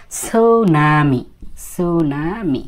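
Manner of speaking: "su-na-mi" stretched very slowly; slow model of "tsunami" without the T; "sunami" with silent T. Shown as a demonstration. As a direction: draw out "sunami", starting on a plain S. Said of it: "টি এর উচ্চারণটা ওরা করে না".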